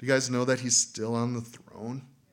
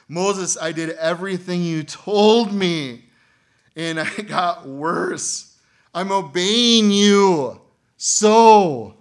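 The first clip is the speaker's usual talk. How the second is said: frustrated complaint; 6 semitones higher, 10 dB louder, slower than usual. Acted. Israelite voiced complaining to Moses.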